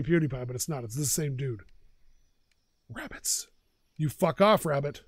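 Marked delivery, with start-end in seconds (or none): 2.9-3.5 hushed whisper; real hushed whisper. Real, not acted. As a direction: whisper so the rabbits don't hear. Spots rabbits in game.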